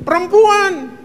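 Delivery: high, pointing the blame at her; Adam blaming. Acted. Adam answering God, pushing blame onto the woman. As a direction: shift the blame, pitched high.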